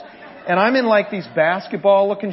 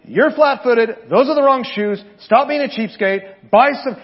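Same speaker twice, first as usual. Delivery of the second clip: imitating the pushy runner; 5 semitones higher, 6 dB louder than usual. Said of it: "he's like".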